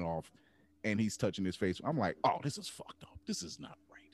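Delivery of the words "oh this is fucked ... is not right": whispered, appalled aside; barely voiced, soft disbelief; hushed dismay. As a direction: whisper it as if quietly devastated. Retold reaction to emotional meme.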